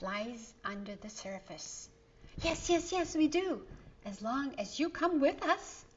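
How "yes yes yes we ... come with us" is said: put-on high, eager children's voices; eager kids chiming in. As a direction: chime in together like excited kids. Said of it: "Both chime in at the same time".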